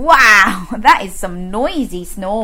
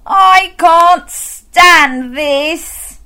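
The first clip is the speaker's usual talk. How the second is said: very slow, louder, drawn-out exasperated groan; fed-up bear; 7 semitones higher, 7 dB louder, much slower than usual. Acted. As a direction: stretch every word like a worn-out bear. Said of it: "said Mister Bear".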